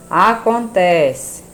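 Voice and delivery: "Acontece" very slow and loud, TE held; read aloud, syllables stressed. Shown as a demonstration. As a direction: stretch "Acontece" out for the class. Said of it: "Lê em voz alta as palavras".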